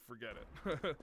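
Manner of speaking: usual speaking voice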